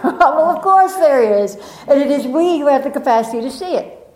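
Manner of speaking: ordinary talk, nothing marked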